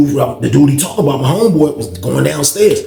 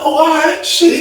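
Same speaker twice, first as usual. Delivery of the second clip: much higher, tense exclamation; mock bracing; 11 semitones higher, slower than usual. Reacting to Thump coming.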